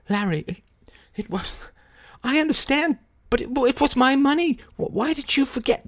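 high strained plea, voice up; pleading. Acted. Kay pleads high and strained with Larry. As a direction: plead desperately.

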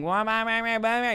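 soft mock whine on repeat; whining broken record. Pastor mimicking the complainers in the chat, mock whine. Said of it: "that's what you sound like".

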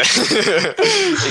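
bursting into real laughter; loud, high laugh. Real, not acted. Reacts to Old Faithful joke.

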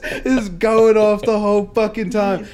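ordinary delivery

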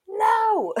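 sharp high no; indignant no. Retold or imitated. To the host after being told not to interrupt, a high indignant no. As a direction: fire back a squeaky refusal. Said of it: "And I was like".

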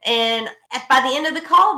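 usual speaking voice

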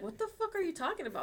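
quick, high-pitched incredulous question; baffled husband. Retold or imitated. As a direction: imitate a bewildered comeback, fast and high. Husband's baffled reply to rant.

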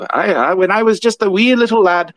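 mock Irish accent. Shown as a demonstration. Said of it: "I was good at an Irish accent".

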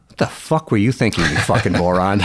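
deadpan mock scolding, nearly conversational; joking callback. Father teasing the calmed-down boy in the car, joking callback. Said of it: "we had this joking relationship".

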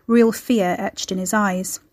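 usual speaking voice

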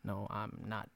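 low, quiet villain denial with crisp final T on "not"; softly insist, popping the T in "not"; hushed, menacing movie-villain impression; Joker. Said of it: "where the Joker's like".

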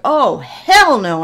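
loud, slow, drawn-out refusal; defiant oh hell no. Retold or imitated. Driver cut off at the light, slow loud refusal. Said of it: "And I'm like".

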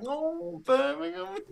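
very high, soft mock Brummie; Birmingham accent. Friends teasing her returned accent, mock Birmingham accent. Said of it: "she had the accent back".

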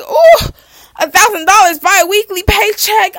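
high, loud mock squeal of delight; excited windfall reaction. Podcaster imagining her first big paycheck, gleefully over-excited. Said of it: "I'm about to be like".

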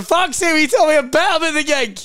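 high, loud mock exasperation; retold outburst, pitched right up; exasperated. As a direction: snap back in comic frustration. Acted exasperated reply to the booker.

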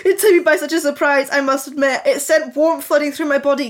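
normal talking voice

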